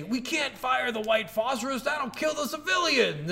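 high-pitched, hammed-up objection; mock panicked protest. Imitating Lugo's protest in game.

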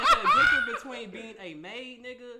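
loud laughing repeat. Shown as a demonstration. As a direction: repeat it big, cracking up. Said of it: "Say that again".

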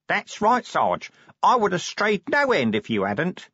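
high-pitched, dutiful underling; virtuous. A narrator's reading. Corporal agreeing with his sergeant's guidance, virtuous. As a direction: agree piously, in a high character voice. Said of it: "said Nobby virtuously".

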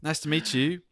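put-on high-pitched, over-polite British accent; timid beta-male Brit asking nicely; meek British accent. Customer ordering tea at tea time, meek mock British accent. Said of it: "the most beta, like, cuck version".

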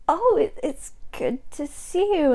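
slow, soft, halting hello; awkward greeting. Acted. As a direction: stumble softly through a forced greeting. Acted awkward encounter with rival.

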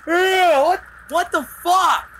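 high, loud startled shout; startled yell. Retold or imitated. To his grandmother at the door, a high startled yell. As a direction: yell it like you were just woken. Groggy guy yelling at grandma.

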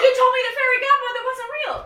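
high, fast, laughing complaint; laughing mock whine. Kid's mock grievance at the parent, laughing. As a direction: whine while cracking up.

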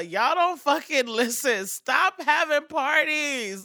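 exasperated. Real, not acted. Scolding Atlanta partygoers.

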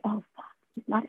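a quiet sinking realization; muttered dismay. Retold or imitated. To herself, crushed by his flat okay, softly dismayed.

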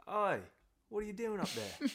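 high, loud Aussie lizard calling up; Australian accent. Acted. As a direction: call out in a joke Aussie accent. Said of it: "not even gonna bother doing the accent".

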